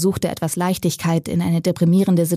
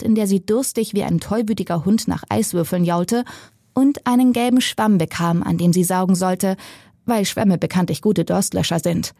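plain reading voice

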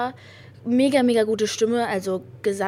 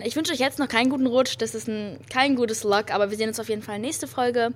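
usual speaking voice

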